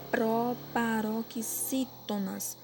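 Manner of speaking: "proparoxítonas" very slow, syllable by syllable; slow dictation. Shown as a demonstration. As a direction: break "proparoxítonas" into drawn-out syllables for copying. Teacher dictating a question slowly.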